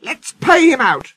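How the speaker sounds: very high, vengeful shriek; high-pitched storybook scream. Acted. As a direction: squeal it out like an outraged gang. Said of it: "screamed the coaches".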